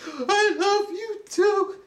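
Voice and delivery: high, slow mock teacher voice; teacher replying high and slow; imitated teacher reply. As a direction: answer back in a high, drawn-out voice. Teacher answering students.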